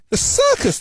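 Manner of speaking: very high-pitched disbelief; incredulous repeated question. A narrator's reading. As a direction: ask THE CIRCUS with pitch leaping right up. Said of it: "the duck asks again".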